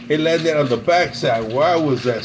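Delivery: usual speaking voice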